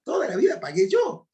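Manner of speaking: delighted incredulous exclaim; amazed. Retold or imitated. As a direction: burst out in happy astonishment. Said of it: "digo, Señor, es verdad".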